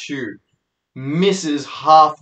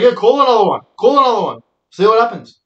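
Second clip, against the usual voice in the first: louder, higher-pitched mocking dare; taunting; 7 semitones higher, 5 dB louder than usual. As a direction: egg her on, imitating the cocky guy. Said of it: "the guy's laughing".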